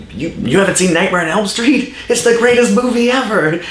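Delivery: put-on high, excited kid raving about a movie; kid gushing. Eight-year-old self on the playground, gushing excitedly. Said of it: "And I'd act it out".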